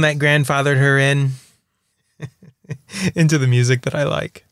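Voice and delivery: normal talking voice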